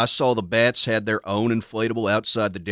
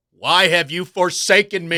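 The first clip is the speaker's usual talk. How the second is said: higher cry to the heavens; mock anguish; 8 semitones higher, 5 dB louder than usual. Sportscaster crying out to God over the loss, mock anguish. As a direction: plead with God, hammed up.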